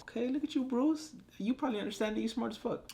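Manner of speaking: very high-pitched playful praise; squeaky-high mock-impressed voice; teasing admiration. Teasing praise addressed to Bruce.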